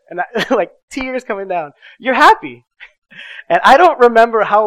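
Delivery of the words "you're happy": put-on louder, higher reassurance over a crying baby; soothing over screams. Father calming screaming foster daughter, loud and soothing. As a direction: comfort her above the screaming.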